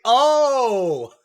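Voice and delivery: high, loud drawn-out oh; acted collective oh of recognition; sudden realization. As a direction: exclaim as the penny drops. Crowd realizes who is meant.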